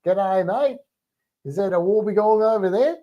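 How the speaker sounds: playful put-on Aussie lilt; teachers copying Australian accent. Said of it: "Even the teachers sometimes are like".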